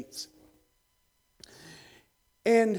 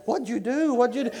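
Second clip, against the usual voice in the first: high-pitched flustered questioning; agitated, repeated mock-frantic demand; mock panic; 7 semitones higher, 5 dB louder than usual. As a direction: imitate an alarmed translator, pitched up. Translator panicking, acted out.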